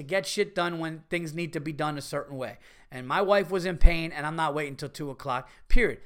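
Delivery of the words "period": loud firm period. Real, not acted. Host ends rant firmly.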